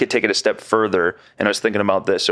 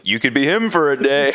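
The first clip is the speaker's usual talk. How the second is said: innocently retold suggestion, higher pitch; 6 semitones higher than usual. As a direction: imitate an oblivious, innocent offer, pitched up. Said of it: "Mike just so innocently was like".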